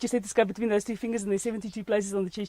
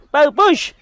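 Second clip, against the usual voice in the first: loud barked command; drill sergeant; 4 semitones higher, 9 dB louder, slower than usual. Acted. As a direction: push them like a drill instructor. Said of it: "Sound like a drill sergeant".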